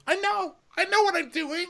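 extremely high mock squeal; piercing caricature of giddy admission; Ramona.

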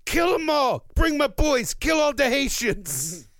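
a Cuban gang boss rallying his boys, mock shouting. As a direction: yell it like a mock Cuban gangster. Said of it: "there's a Cuban guy being like".

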